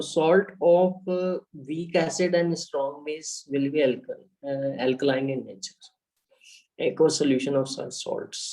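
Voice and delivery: very slow, word by word, for students to write down; slow dictation. Acted. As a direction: dictate it slowly so the class can copy. Teacher dictates definition for notes. (बहुत धीरे, लिखवाने वाले अंदाज़ में)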